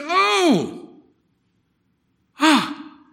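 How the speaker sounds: high, loud gasped oh of revelation; a loud, high cry of discovery; sudden realization. Retold or imitated. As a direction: let it dawn on you all at once. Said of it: "It was like a illumination".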